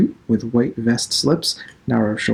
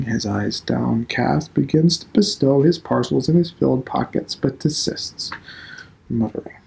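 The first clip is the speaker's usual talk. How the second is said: ordinary delivery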